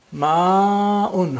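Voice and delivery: "Ma'un" as maaa-un with a clean break; lengthened before hamza. Shown as a demonstration. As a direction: stretch the a of "Ma'un", then bring out the hamza clearly. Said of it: "bring out the hamza".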